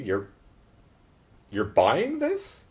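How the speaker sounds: slow, rising disbelief; drawn-out mock disbelief; incredulous. Acts out characters' disbelieving looks.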